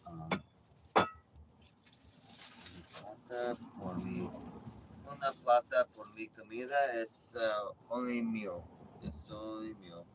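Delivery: a joking bit of broken Spanish; mock Spanish. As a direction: play to the Spanish-speaking audience. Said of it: "That's for my Spanish speaking audience".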